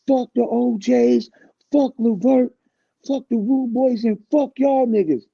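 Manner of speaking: loud, high, slow cursing rant; high furious shout. Retold or imitated. Tretch yelling curses at the group, loud high rant. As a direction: spit each fuck out loud and high.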